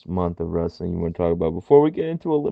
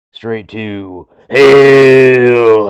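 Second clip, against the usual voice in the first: loud, long-drawn roar on hell; dramatic roar; 3 semitones higher, 13 dB louder, much slower than usual. Acted. Boss banishing a wrestler to hell, dramatic roar. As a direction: stretch it out into a booming threat.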